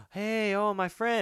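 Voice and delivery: high-pitched imitated surprise; mock delighted greeting. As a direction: act out startled friendliness.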